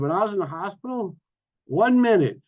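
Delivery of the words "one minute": high, loud hold-on; excited interjection. Real, not acted. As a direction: cut yourself off, suddenly eager.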